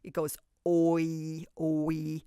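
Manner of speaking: "oi" drawn out slowly, one gliding syllable; oi sound demo. Shown as a demonstration. Teacher shows children how the digraph "oi" sounds. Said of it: "O-I, very common digraph".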